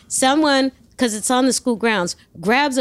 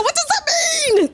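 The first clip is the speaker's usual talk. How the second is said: very high laughing squeak, confused; clueless squeak. Retold or imitated. Learner driver asks friend what it means, high giggly squeak. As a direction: sound totally lost and giggly. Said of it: "I go".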